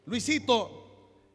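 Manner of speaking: long drawn-out loud call; calling a child. Acted. Father calling his son Luisito, drawn out and loud. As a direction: stretch the name out across the house.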